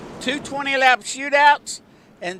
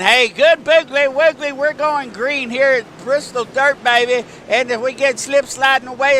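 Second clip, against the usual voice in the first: louder and higher, hyped up for the green flag; race start hype; 3 semitones higher, 6 dB louder than usual. Real, not acted. Announcer calling the green flag at Bristol Dirt, hyped and loud. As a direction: sell the start of the race to the crowd.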